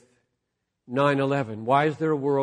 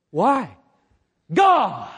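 high, loud cry to the heavens; a joyful outburst, higher and louder; exclaimed; 7 semitones higher, 7 dB louder than usual. Shown as a demonstration. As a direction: fling it up in bold exclamation. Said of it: "I say it like that".

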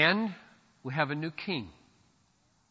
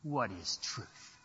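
Pilate's dismissal; 9 semitones lower, 15 dB quieter, slower than usual. Retold or imitated. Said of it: "Pilate damned himself".

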